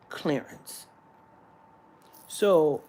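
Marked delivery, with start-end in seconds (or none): 0.1-0.9 stunned whisper. Real, not acted. Comedian repeating the clerk's insult, whispered. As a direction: whisper the word clearance in disbelief. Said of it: "unvoiced repeat of the word".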